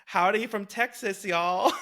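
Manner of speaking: mock-Texan howdy with a lilt; playful Texan twang. Host teasing her guest's howdy, playful Texan twang.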